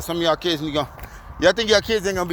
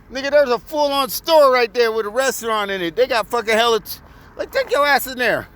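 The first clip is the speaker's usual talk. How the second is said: put-on exasperated adult scolding a rude kid, raised pitch; retold angry telling-off; 6 semitones higher than usual. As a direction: snap at him like you're fed up.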